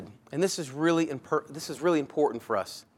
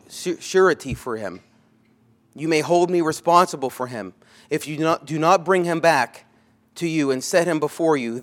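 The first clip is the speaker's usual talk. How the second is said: louder, forceful preaching read; scripture reading; 7 dB louder than usual. Acted. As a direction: proclaim the verse to the hall.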